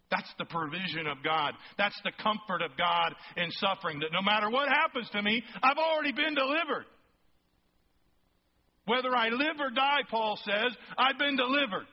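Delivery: fervent preacher ringing out "I've already been delivered"; preaching climax. Acted. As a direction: rise to a triumphant cry from the pulpit. Sermon climax building to a cry.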